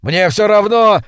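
high, loud defiant shout; straining yell. A narrator's reading. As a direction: scream it back at the top of the voice. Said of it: "надрывался Лентри".

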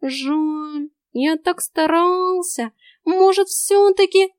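slow, high and wheedling; mock-disappointed cat. A narrator's reading. Cat feigns disappointment to her, slow and high. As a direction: pretend hurt, coax her.

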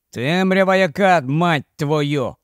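plain reading voice

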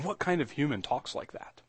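softly wondering question, under the voice; hushed wonder. Real, not acted. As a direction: ask it quietly, almost to yourself. Asks it low, then repeats it forcefully.

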